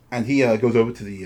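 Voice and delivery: ordinary talk, nothing marked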